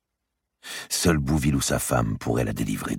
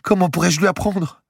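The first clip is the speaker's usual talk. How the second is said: much higher and louder; despairing young woman; 6 dB louder than usual. Acted. Marie despairing to Madame de Bouville, higher louder voice. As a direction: cry it out helplessly.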